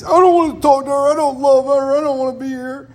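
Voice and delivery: put-on high, loud, pouting child's protest; sulky angry kid. An angry kid refusing to talk to his sister. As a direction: whine it like a furious little kid.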